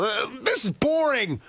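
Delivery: put-on high whiny child complaining; mock whine of a bored kid. Kids complaining about TV.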